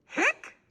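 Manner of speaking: one very high hiccup squeak; squeaky hiccup. A narrator's reading. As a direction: pop out a tiny hiccup. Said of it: "she hiccuped again".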